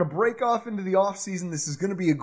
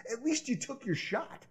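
whisper; 5 semitones higher, 10 dB quieter than usual. Real, not acted. Aside on draft long shots.

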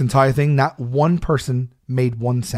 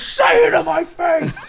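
high, slow, stretched-out confrontational shout; imitated angry yell; slower than usual. Wrestler right in the heckler's face, angry yelling. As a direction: play the enraged wrestler demanding an answer. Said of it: "while he's yelling at me".

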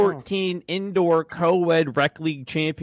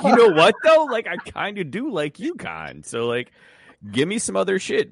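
mock self-quote; 7 semitones higher, 4 dB louder than usual. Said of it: "And I was like".

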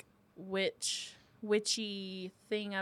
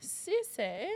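tentative British accent, slightly higher; attempted British accent; 3 semitones higher than usual. Shown as a demonstration. [name] trying the name on request, tentative British accent. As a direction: try a British accent, unsure of it. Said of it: "say it in a British accent".

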